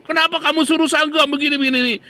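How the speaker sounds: loud, high-pitched angry demand; acted raging. Recipient demands why Sanggam was sent, raging. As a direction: imitate a man blowing up at a messenger. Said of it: "Orangnya ngamuk-ngamuk".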